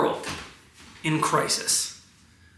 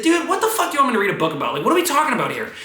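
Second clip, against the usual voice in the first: high, rapid exasperated retort; re-enacted argument, pitched up; yelling back; 7 semitones higher, much faster than usual. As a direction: throw it back in heated disbelief. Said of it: "when I yell back at you".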